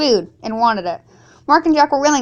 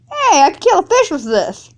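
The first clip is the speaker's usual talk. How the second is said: excited; 9 semitones higher, 4 dB louder than usual. Acted. Finds a weapon, cries out.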